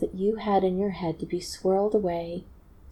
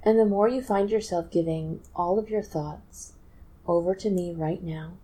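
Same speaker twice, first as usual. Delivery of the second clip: soothing hypnotist voice with long pauses between phrases; measured, trance-inducing guided relaxation; hypnotic induction. Acted. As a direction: lull them down into trance, phrase by phrase.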